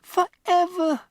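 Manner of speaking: put-on high, slow, loud child's cry; the worst news; despairing girl. Matilda to Miss Honey, high despairing cry. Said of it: "Matilda cried".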